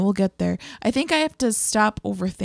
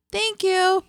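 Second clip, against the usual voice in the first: pitched way up and awkward; awkward high thank you; 10 semitones higher than usual. Retold or imitated. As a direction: squeak it out, flustered. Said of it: "so it came out like that".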